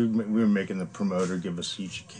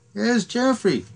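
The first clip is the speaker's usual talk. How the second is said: high-pitched put-on tour manager voice; Jimmy; 13 semitones higher, 4 dB louder, slower than usual. Tour manager spotting his friend in the field, high mock call. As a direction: imitate him pointing out a target, pitched way up. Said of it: "Jimmy, the tour manager, goes".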